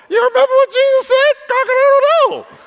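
high, loud crowing rooster voice. Retold or imitated. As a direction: crow it out like a gotcha. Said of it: "The rooster had to tell him".

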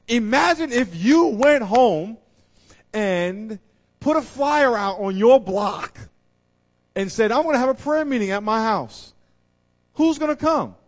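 slow, high, loud preaching; preaching at full force. Real, not acted. Preacher picturing a prayer meeting for the church, loud and high. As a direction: lean in and call it out.

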